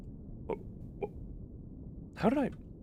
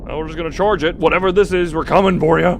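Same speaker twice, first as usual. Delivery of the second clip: louder, higher, gung-ho; rally yourself to charge in; bold charge; 6 semitones higher, 10 dB louder, faster than usual. Real, not acted. Player charging at the unknown thing, loud and gung-ho.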